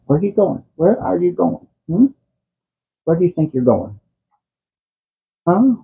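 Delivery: high, soft coaxing question. Real, not acted. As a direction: softly ask the wandering cat. Dad asks cat walking off.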